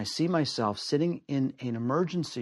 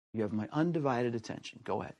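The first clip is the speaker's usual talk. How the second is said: soft reassurance; 2 semitones lower, 8 dB quieter than usual. Retold or imitated. Soothing an upset woman.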